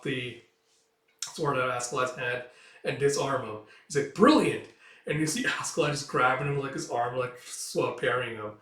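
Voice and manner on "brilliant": high, loud burst of admiration; delighted exclamation. Acted. Onlooker to a fighter who disarms, admiring shout. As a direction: applaud the clever move aloud.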